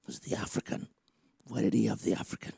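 hushed, low muttered question; brooding thought. Acted. Listener puzzling for days over the visiting preacher, muttered. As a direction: mull it over under your breath. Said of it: "bother you for days thinking".

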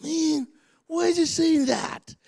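very high put-on whine; upset listener. Listener bothered by what the preacher says, mock upset. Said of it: "I want you to be like".

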